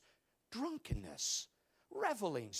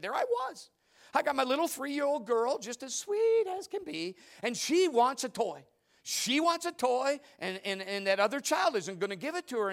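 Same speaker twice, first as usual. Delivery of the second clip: high, loud, excited mom retelling; imitating Christina; 7 semitones higher, 6 dB louder than usual. Preacher imitating Christina telling nursery story, high and loud. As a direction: gush it like a worked-up young mother. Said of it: "She said".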